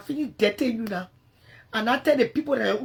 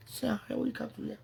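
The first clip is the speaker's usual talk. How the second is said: usual speaking voice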